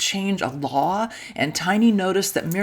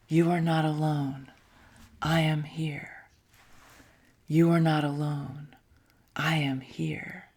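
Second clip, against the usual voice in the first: whispered echo; 3 semitones lower, 5 dB quieter than usual. A narrator's reading. Narrator whispers the god's echo to children, softly. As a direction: breathe the words as a fading echo. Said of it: "You can hear the echo".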